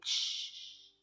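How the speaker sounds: normal talking voice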